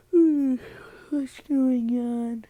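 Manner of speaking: groggy, drawn-out waking hum and question. Acted. Katsumi wakes up beside Deku, sleepy and drawling. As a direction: stretch it slowly, as if just roused. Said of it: "Katsumi wakes up".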